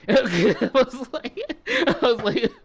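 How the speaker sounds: talking through laughter; laughing. Real, not acted. Storyteller cracking up at own retold reply, laughing. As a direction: barely get it out, laughing.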